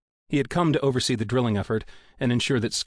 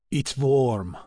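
put-on Russian accent; 3 semitones higher than usual. A narrator's reading. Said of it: "Yevchenkov's rolling accent".

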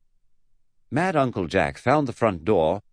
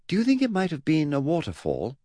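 put-on higher-pitched boy's tentative idea; helpful suggestion; 9 semitones higher than usual. Eddie to great-uncle, helpful high-voiced suggestion. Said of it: "Eddie suggested helpfully".

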